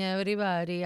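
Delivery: ordinary talk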